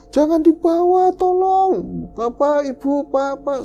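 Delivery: very high, drawn-out calling; retold cry for help. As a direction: call out desperately for the family. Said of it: "Itu dengar saya teriak-teriak". (teriak minta tolong, melengking tinggi)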